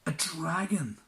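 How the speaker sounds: high, loud astonishment; shocked. Acted. Prince hearing of the dragon, loud high shock. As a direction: gasp it out in shock. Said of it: "said the prince".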